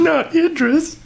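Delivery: high, drawn-out hammed-up lament; mock anguished wail. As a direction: stretch it out like a mock tragedy. Echoes mock dramatic cry.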